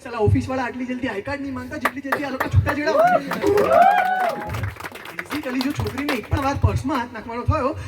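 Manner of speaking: worked-up stage tirade, punchlines pushed hard; exasperated comic rant. Acted. Yash complains to his friend about girlfriends, exasperated ranting.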